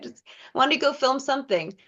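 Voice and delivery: normal talking voice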